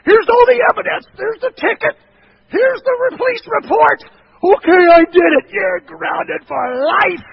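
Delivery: a fuming parent slapping down proof and yelling the sentence; furious parent. Pretend. Parent confronting a guilty teen, shouting furiously.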